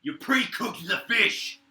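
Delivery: a loud high outraged cry, slowed down; mocking yell. Guard yelling at the fish-bringer, outraged. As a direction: yell it in disbelief. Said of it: "He goes".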